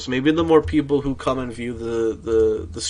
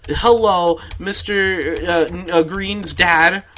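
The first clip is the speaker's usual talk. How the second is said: higher, louder put-on teacher voice; mock-formal phone greeting, pitched up; 6 semitones higher, 6 dB louder, slower than usual. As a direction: imitate an overbearing teacher calling a parent. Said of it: "she's like".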